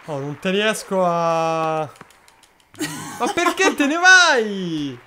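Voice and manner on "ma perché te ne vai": high, slow exasperated shout; drawn out frustrated plea. Real, not acted. Player wandering off in game.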